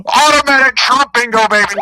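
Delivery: high loud shout; bingo shout. Real, not acted. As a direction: announce it full blast. Bingo call.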